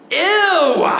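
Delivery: put-on short disgusted "Ew" from a four-year-old; a mock kid's grossed-out "Ew". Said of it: "he goes".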